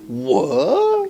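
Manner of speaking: incredulous squeak. Real, not acted. Incredulous reaction to plot.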